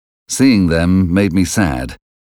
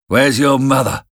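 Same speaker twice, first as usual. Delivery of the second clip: raised, gruff demand; menacing convict; 6 semitones higher than usual. A narrator's reading. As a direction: question the boy roughly. Convict questions the boy.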